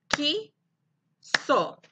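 "Quiso" as two isolated beats, qui and so; long pause inside "Quiso"; clapped syllables. Shown as a demonstration. Teacher repeats for clapping pupils, "Quiso" broken in two. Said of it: "Aplaudan conmigo".

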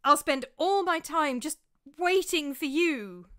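despairing wail. A narrator's reading. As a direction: beg to be forgiven, slowing. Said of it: "I wailed in desperation".

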